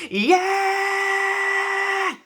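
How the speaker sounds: long, high screamed yeah; loud drawn-out metal yell; imitated rock scream. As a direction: belt out a sustained frontman's scream. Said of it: "literally him screaming".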